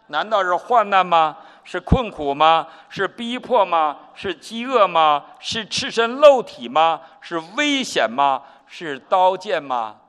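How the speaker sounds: slow, loud, rising at each question; preaching scripture reading. Acted. Preacher reading Romans aloud to the congregation, slow and loud. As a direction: proclaim every line as a challenge to the hall.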